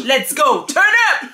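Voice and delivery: loud hype-man call; hype shout. Pretend. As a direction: shout it like a rapper. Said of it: "en style Balou".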